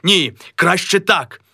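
high, solemn toast; a high, pompous toast; grand toast. Acted. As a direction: raise a glass to yourself. Mazailo toasts himself.

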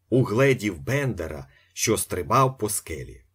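usual speaking voice

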